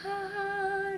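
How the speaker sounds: high, drawn-out "ahh"; relieved sigh. Shown as a demonstration. Demonstrates relief of spreading toes.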